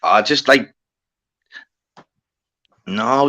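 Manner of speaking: normal talking voice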